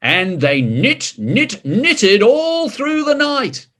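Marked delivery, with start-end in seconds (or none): none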